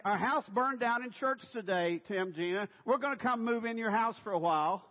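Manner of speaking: high, loud, matter-of-fact imposition; brazen announcement. Acted. Pastor phoning friends after a house fire, brazen announcing. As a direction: announce it loudly, leaving no room to refuse.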